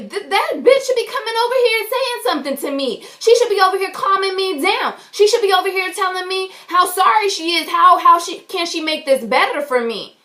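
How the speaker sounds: high-pitched, indignant retold tirade; indignant rant. Customer wants manager to come over, indignant rant. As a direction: demand an apology, voice riding high.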